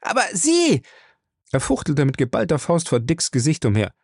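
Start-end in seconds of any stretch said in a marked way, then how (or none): 0.0-0.8 furious taunt. Acted. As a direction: spit the jealousy jab at him. Ray rages at Dick. (wütend höhnisch, schnell)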